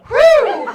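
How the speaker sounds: wild mock whoop. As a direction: let out a sudden whoop. Joking about the student in back.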